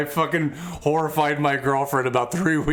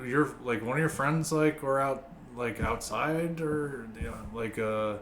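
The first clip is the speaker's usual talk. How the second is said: fumbling for words, lower and softer; imitating an awkward mom who stalls on every phrase; hesitant, awkward; 7 semitones lower, 7 dB quieter than usual. Mom awkwardly asking her son about the lawn, hesitant. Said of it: "she didn't know how to, like, s-, like, ask me".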